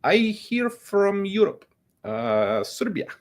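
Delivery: high-pitched put-on European accent; squeaky mock European newcomer asking for handouts. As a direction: fake a halting European immigrant accent. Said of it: "would say it in a European accent".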